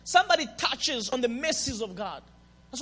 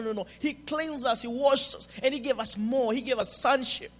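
ordinary talk

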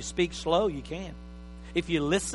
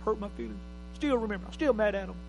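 quiet rueful recall; 7 dB quieter, faster than usual. Retold or imitated. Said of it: "Every one of you go".

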